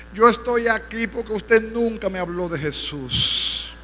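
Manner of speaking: slow, high lament; anguished reproach. Acted. Damned neighbor blaming the evangelist who never preached to him, slow lament. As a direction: draw out the accusation as a slow wail. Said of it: "el vecino lloraba fuerte".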